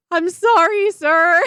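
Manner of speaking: apologizing to the truck driver with a hint of a laugh; sheepish apology. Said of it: "I'm like".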